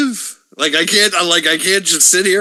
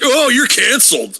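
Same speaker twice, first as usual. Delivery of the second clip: high-pitched mocking crowd voice; mock outrage; 8 semitones higher than usual. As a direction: taunt like a cancel mob. Mimicking a cancel mob.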